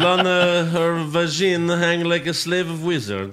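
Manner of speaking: put-on loud, high Bulgarian accent; hammy Borat-style broken English. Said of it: "he's Borat".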